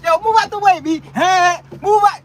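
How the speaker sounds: high, loud impatient driver; high, punchy road-rage holler; impatient yelling. Acted. Impatient driver yelling at slow traffic.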